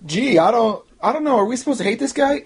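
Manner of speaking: high-pitched sarcastic puzzlement; mock cluelessness. Reviewer plays a confused viewer, high mock cluelessness. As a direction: play dumb, voice pitched well up.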